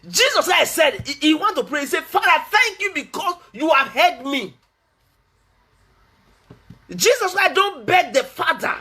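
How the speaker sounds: high, emphatic preacher's proclamation; forceful preaching. Real, not acted. Preacher explaining how Jesus knew the Father, forceful preaching. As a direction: drive each point home, pitched high.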